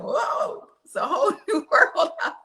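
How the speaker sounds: high-pitched whoa, laughter creeping in; acted wonder, high and giggly; mock amazement. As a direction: imitate a stunned parent, half laughing. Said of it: "you'll be shocked".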